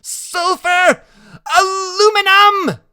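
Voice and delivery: high, loud, stretched-out cry; boy shouting in high-pitched delight; excited shout. A narrator's reading. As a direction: call it out gleefully at the fireworks. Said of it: "Freak keeps on shouting out the names".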